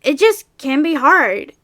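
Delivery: slow, put-on cartoon character voice; Cussy Cookie the third. Shown as a demonstration. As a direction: stay in the cartoon voice, drawing it out. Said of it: "sorry to, um, go into my regular speaking voice".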